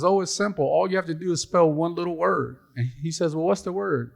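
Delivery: high, sweetly sly wife voice; high, innocent voice that is secretly taking revenge. Acted. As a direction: set up the trap with fake sweetness. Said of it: "She says".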